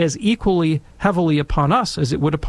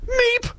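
put-on a frightened child's squeak; tiny squeak. Dumbfounded boy squeaking at his stern teacher, tiny high squeak. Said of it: "thinking, you know".

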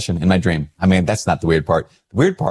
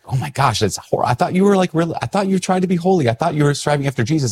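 high, scandalized mock lady; shocked church lady; 8 semitones higher than usual. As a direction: act out her dismay, pitched up. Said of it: "that's how they might respond".

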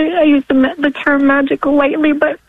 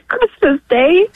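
high voice welling with tears; real, overcome with gratitude; tearful, pausing to hold it together; emotional; 4 semitones higher than usual. Real, not acted. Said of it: "wasn't expecting to get so emotional".

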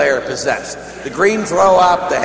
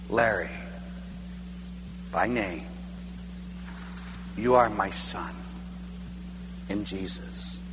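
low, hushed voice of God, long pauses; deep inner voice; 8 semitones lower, 13 dB quieter than usual. Retold or imitated. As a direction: speak softly and tenderly, phrase by phrase. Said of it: "I heard deep within me".

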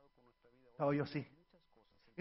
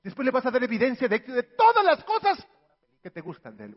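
ordinary talk

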